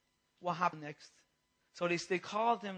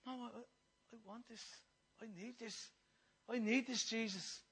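very soft, halting plea; quiet pleading; 8 dB quieter than usual. Retold or imitated. Believer answering those who say sit down, quiet pleading. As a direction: plead under your breath, with pauses.

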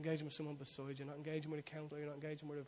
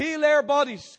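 fervent prayer shout; 12 semitones higher, 23 dB louder, slower than usual. Acted. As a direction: shout the prayer high and loud. Call and response prayer.

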